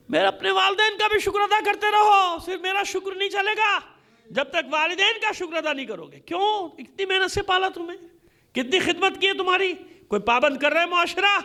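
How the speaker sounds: ordinary delivery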